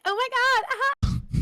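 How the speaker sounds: high squealing valley girl mock. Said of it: "how he mocks women".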